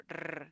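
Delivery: R as a strongly rolled trill. Shown as a demonstration. Teacher showing learners the stronger R sound, strongly trilled. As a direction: roll the R hard on the tongue. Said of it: "the sound is stronger".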